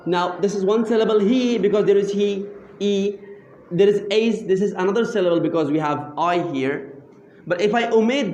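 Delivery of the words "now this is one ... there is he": usual speaking voice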